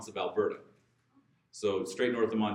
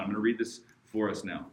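normal talking voice

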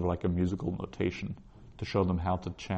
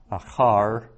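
Achar with CHAR stressed and louder; stress on final syllable; 5 dB louder than usual. Shown as a demonstration. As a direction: hit the last syllable of Achar. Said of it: "which syllable you put the stress on".

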